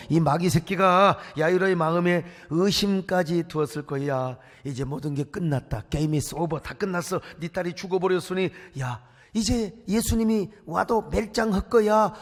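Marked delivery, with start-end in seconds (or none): 4.6-12.1 low, quiet, sly voice; taunting devil. Acted. Devil taunting Jairus with doubt, low and quiet.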